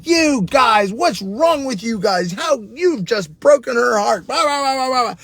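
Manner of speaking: replaying a self-righteous telling-off; retold righteous anger. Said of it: "rage, anger, righteous anger".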